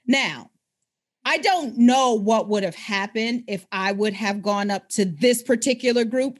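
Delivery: ordinary talk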